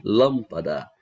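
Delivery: "Lâmpada" with a punched first syllable "Lâm"; stress on LÂM. Shown as a demonstration. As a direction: hit "Lâm" hard, falling away on "pada" in "Lâmpada". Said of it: "A sílaba que é pronunciada com mais força". ("Lâmpada" com ênfase forte no "Lâm")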